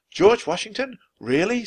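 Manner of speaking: a little sister who can't believe it; amazed girl. A narrator's reading. Annie amazed at the news.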